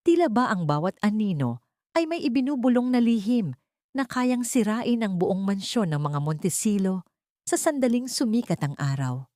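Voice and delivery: plain reading voice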